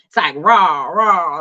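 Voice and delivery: lightly voiced growling stomach dragon; a playful low roar, lightly voiced; dungeon dragon. Her hungry stomach mimicked as a playful dragon roar. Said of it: "my stomach is like ... like a dungeon dragon".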